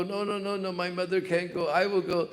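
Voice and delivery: high-pitched protesting calf; arguing calf. Retold or imitated. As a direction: refuse urgently, pitch up high. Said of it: "They were all fighting like this".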